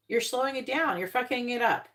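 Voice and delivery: normal talking voice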